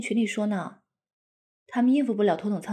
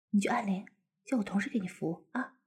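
whisper; 3 semitones lower, 6 dB quieter than usual. Acted. Whispering to husband in cabin.

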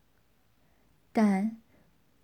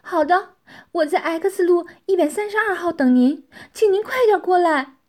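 higher, louder voice of Xu Tianqi; urgent female caller; 7 semitones higher, 8 dB louder than usual. A narrator's reading. Xu Tianqi giving Tianxiang her address by phone, urgent and high. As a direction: press him to hurry over, pitched up and loud. Woman on phone urging him over.

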